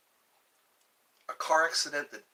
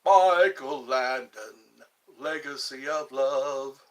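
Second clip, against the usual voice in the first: slow, loud movie-trailer voice; hammed-up promo announcer, louder and slower; 8 dB louder, slower than usual.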